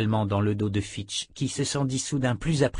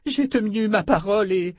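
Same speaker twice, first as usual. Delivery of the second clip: high, whimpering protest; gémissement plaintif; 10 semitones higher than usual. A narrator's reading. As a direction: moan it as a desperate plea. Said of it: "gémit Landérienne". (protestation gémie, voix aiguë)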